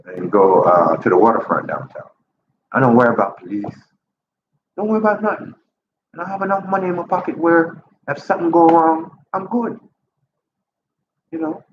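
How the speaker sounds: usual speaking voice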